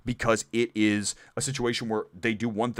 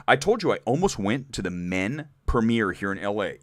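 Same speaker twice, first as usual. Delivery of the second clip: normal talking voice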